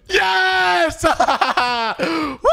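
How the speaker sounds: high, loud cheering yell with laughter; triumphant yelling after winning; victory shout. Real, not acted. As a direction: shout out the win. Celebrating winning the last round.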